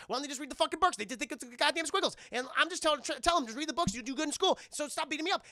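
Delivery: put-on high, loud, fast kid rant; nervous kid rambling.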